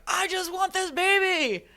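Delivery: high, loud pleading cry; reliving the yell in labour, high and loud; retold birth yell. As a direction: yell it out mid-labour, desperate for the baby. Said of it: "I was screaming and yelling".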